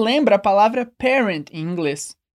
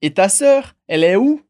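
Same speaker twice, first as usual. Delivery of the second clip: "Et ta sœur, elle est où" pushed upward at "sœur" and again at "où"; rising question intonation; 4 dB louder than usual. Shown as a demonstration. French teacher leading learners in repetition, rising intonation. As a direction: make "où" climb clearly at the end. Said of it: "repete comigo com a entonação".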